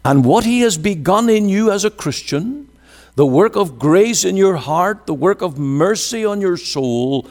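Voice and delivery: high, swelling preacher's cadence; fervent preaching. Real, not acted. Preacher assuring believers of the work begun in them, fervent. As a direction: lift the voice and press each phrase on the congregation.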